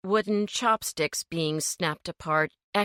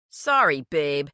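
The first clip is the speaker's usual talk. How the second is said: unsympathetic; 3 semitones lower, 4 dB louder than usual. A narrator's reading. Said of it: "Gary answered without much sympathy".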